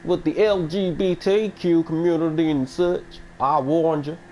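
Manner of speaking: high, drawn-out Southern drawl; hammed-up Southern impression, pitched high; mock Southern accent. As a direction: give a joke warning in a Southern accent. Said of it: "making fun of the Southern accent".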